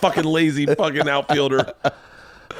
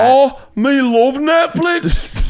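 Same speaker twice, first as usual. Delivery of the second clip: high, slow, botched Scottish woman; hammed-up bad Scottish accent, drawn out; female Scottish accent; 10 semitones higher, 4 dB louder, much slower than usual. Scottish chick chatting about Netflix, badly faked Scottish accent. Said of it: "I'll do female Scottish accent".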